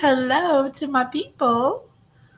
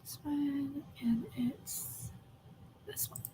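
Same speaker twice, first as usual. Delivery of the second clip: whisper; 3 semitones higher, 16 dB quieter, slower than usual. Real, not acted. Fumbling for the clip.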